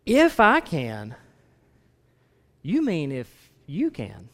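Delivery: slow, incredulous exclamation; exclaiming. Acted. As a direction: drag out the words in disbelief. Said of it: "he's exclaiming that".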